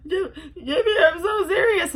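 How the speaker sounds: imitating mom laughing-crying. Mom insisting to her son, laughing and crying. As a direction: play mom half laughing, half sobbing. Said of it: "She goes".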